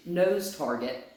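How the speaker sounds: normal talking voice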